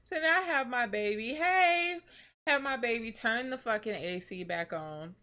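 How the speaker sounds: normal talking voice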